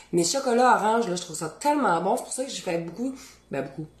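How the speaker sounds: ordinary delivery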